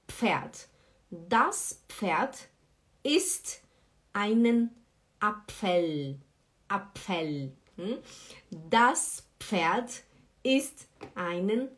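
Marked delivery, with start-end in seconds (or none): none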